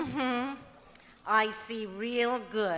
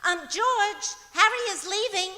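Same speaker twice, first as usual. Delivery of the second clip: calling out; 7 semitones higher, 6 dB louder than usual. Acted. Calling out to George.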